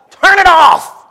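high, loud shouted command. Acted. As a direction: bark it out as God's order. Preacher voices God's command.

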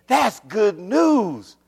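drawn-out proclamation, pitch swooping high; stretched, ringing announcement; rising exultation. Real, not acted. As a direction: proclaim it slowly and fully. Repeats it louder to congregation.

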